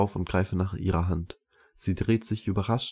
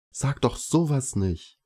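higher, slow indignant protest; 6 semitones higher, slower than usual. A narrator's reading. Luna scolds the sketcher for belittling the bird, indignant. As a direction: protest as if mildly scandalized. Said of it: "sagt Luna empört".

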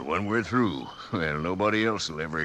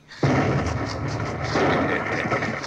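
henchman's laugh. Acted. Henchman mocks his boss.

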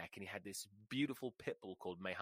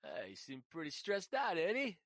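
relaxed roommate; 8 semitones higher, 4 dB louder than usual. Retold or imitated. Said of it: "completely relaxed, and goes".

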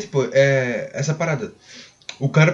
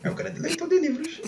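voz fina e zombeteira; mock solene; 14 semitones higher, 7 dB quieter than usual. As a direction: debochando do leitor culto. Zoa o colega leitor. (tom de gozação exagerada)

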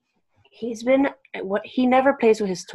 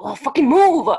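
loud, higher angry yell at someone in the way; shouted move; 7 semitones higher, 10 dB louder than usual. Retold or imitated. Yelling at a stranger in her way, loud angry shout. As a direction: bark it at a blocker on the sidewalk. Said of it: "I'm like".

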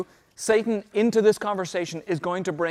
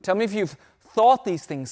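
usual speaking voice

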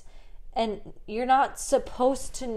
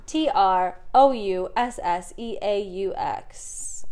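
slow spelling; slower than usual. Shown as a demonstration. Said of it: "Let me spell this for you".

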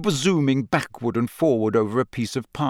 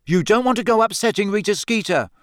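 high, anxious caution; pitched-up nervy warning; nervous; 9 semitones higher than usual. A narrator's reading. Ron warning Hermione off Rita, nervous. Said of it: "said Ron nervously".

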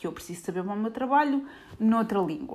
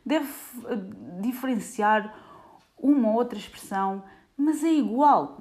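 high, loud, incredulous protest; retelling her own outraged disbelief; indignant self-quote; 5 semitones higher, 6 dB louder than usual. Retold or imitated. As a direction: insist it's the same language, exasperated. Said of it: "eu assim".

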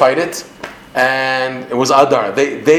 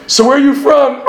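high, laughing imitation of the judge's question; laughing quote; 11 semitones higher, 4 dB louder than usual. Teacher laughing as he quotes the judge's chat in court. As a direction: crack up while quoting him.

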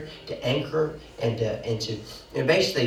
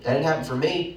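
ordinary delivery

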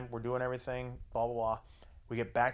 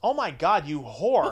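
loud, higher imitation of a shocked mom; mock scandalized; 3 semitones higher, 7 dB louder, slower than usual. Mom teasing her daughter over the phone, scandalized. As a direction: exclaim it in gleeful mock outrage. Retold mother's scandalized reply.

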